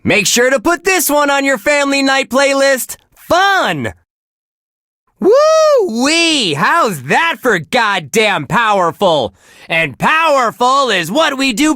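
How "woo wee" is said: high drawn-out DJ whoop; showy, high-pitched cheer; excited whoop. Acted. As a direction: whoop it up after a great track. DJ whoops after a song.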